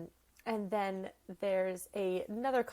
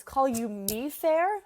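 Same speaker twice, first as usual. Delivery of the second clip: louder, lightly acted Shakespearean retort; Helena retorting; 2 semitones higher, 6 dB louder, slower than usual. Helena turns around to answer Hermia, lightly acted retort. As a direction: turn on her and throw it back, projected. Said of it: "Helena turns around and says".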